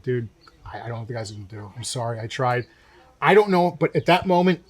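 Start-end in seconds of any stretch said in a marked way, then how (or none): 0.0-2.7 low apologetic aside. Retold or imitated. Retold sheepish apology to his server.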